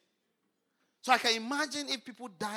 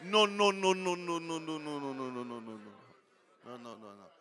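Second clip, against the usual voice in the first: fading refusal; 7 semitones lower than usual. Real, not acted. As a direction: shake it off until it dies away. Preacher rejects resting, fading out.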